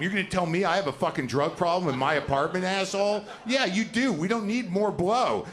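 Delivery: hammed-up furious flashback quarrel; drug-fuelled rant. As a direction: yell it like a coked-up roommate. Retold cocaine argument flashback.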